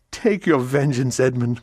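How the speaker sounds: a mother crying out; anguished cry. Acted. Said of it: "cried the poor mother".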